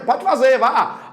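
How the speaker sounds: normal talking voice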